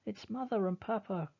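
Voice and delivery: high, joyful relief; excited cry. Acted. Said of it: "cries Martha".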